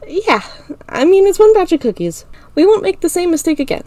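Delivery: put-on high, forced-cheerful girl; brave friend. A narrator's reading. Ira hiding her tears, high and forced-cheerful. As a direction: put on a happy front, high.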